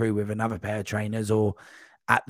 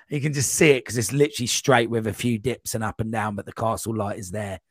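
ordinary delivery

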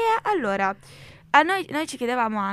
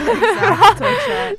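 laughing; 12 semitones higher, 16 dB louder, much slower than usual. Real, not acted. Laughing at the joke.

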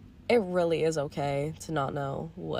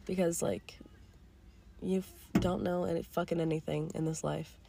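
whispering; 8 dB quieter than usual. Real, not acted. Softly confides mid-rant.

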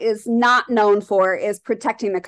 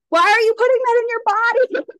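much higher, incredulous outburst; exasperated disbelief; 12 semitones higher than usual. Retold or imitated. Complaining about people smoking chemical joints, in a high, incredulous voice. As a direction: fling the question up in disbelief.

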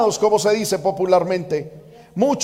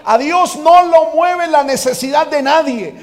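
ordinary talk, nothing marked

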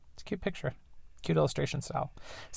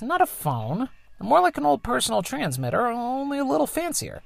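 higher, lightly put-on mother voice; mom's voice; 11 semitones higher than usual. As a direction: play the tinkering engineer mom, a bit higher. Voices the mom.

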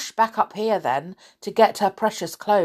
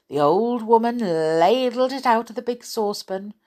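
plain reading voice

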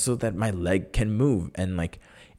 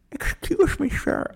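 high, creaky mock old man; hammed-up old person voice, much higher; slower than usual. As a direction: squeak it out like a fussy old man. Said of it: "this is my old person voice".

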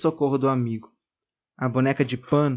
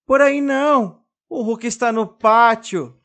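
high, louder panicked warning; desperate shout; 8 semitones higher, 5 dB louder than usual. A narrator's reading. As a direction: cry out in terror as he jumps. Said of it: "grita desesperadamente".

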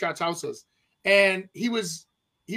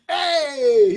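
loud, higher-pitched acted "hey"; mock calling out; 6 semitones higher, 6 dB louder than usual. Retelling an agent hailing a celebrity at a coffee shop, calling out. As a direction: call out as if grabbing someone's attention. Retold celebrity-spotter calls out.

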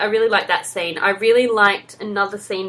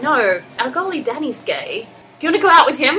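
higher and louder, eager coach; the coach rushing to set him up; hammed-up eager coach; excited coach quote; 7 semitones higher, 5 dB louder than usual. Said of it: "And he's like".

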